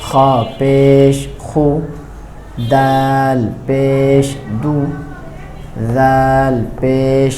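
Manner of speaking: drawn-out teacher recitation of letters; slow letter drill. Shown as a demonstration. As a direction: chant each letter slowly for students.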